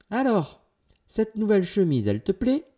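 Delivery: loud, high, cheery question; lively uncle's booming query; eager uncle. A narrator's reading. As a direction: ask eagerly about a present. Uncle asks niece about gift.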